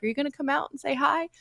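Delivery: lightly voiced cat, high and quick; cat's imagined plea.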